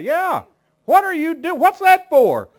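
put-on high, loud whiny kid voice; child's squeal. Confused child whining at the parent before a whipping. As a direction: squeal it like a bewildered little kid.

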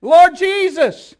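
loud high pleading cries; crying out. Retold or imitated. Blind beggars crying out to passing Jesus, loud pleading. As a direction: beg at the top of your lungs. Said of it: "look at what they began to do".

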